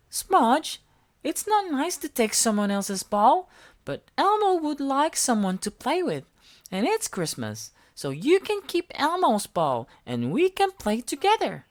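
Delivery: small eager monster voice; Elmo voice. A narrator's reading. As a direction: squeak it up like Elmo. Elmo answers Smudge.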